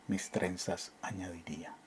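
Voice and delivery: hushed whisper at the line's end. Acted. Reading the poem to students, dropping to a whisper. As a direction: breathe the words out secretly.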